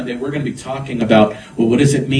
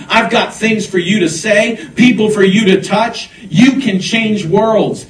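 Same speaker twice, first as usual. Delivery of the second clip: raised, driving preacher's voice with punched key words; fervent preaching; 5 semitones higher, 4 dB louder than usual. Real, not acted. Preacher urges congregation to be bold witnesses, fervent preaching. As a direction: preach it with rising urgency, pitched high.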